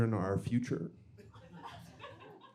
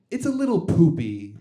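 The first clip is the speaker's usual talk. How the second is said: loud, higher mock kid; higher-pitched child imitation; kid's voice; 5 semitones higher, 8 dB louder than usual. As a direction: blurt it out like a proud kid. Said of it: "And the kid said".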